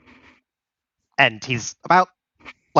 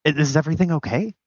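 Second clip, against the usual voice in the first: higher, quickened questions; concerned questioning; 7 semitones higher, much faster than usual. Acted. Pierre asking his friend about the hunter talk, high concerned voice. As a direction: press with concern, pitch raised.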